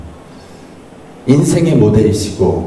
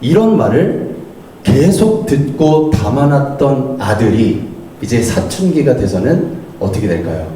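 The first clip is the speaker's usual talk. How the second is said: usual speaking voice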